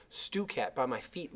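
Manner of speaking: ordinary delivery, nothing marked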